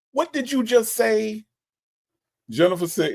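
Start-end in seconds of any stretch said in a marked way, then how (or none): 0.1-1.4 indignant question. Pretend. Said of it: "first thing out of my mouth".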